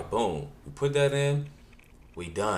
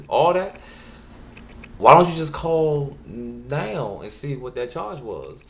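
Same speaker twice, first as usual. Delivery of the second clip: exasperated son; 6 semitones higher, 6 dB louder than usual. Retold or imitated.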